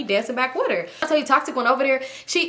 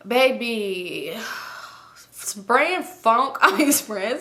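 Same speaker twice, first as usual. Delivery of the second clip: usual speaking voice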